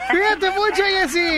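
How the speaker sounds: despedida aguda de locutor; despídela con entusiasmo, en tono alto; despedida efusiva. Real, not acted. Despide a la oyente al aire. (despedida entusiasta)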